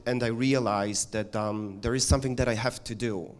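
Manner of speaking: normal talking voice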